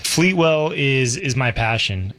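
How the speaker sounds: normal talking voice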